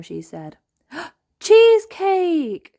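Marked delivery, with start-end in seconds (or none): 0.9-1.4 mild gasp. Acted. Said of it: "gasped Mandy". 1.4-2.7 high, loud, slow delight; thrilled by the cake; excited gasp. Acted. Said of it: "gasped Mandy".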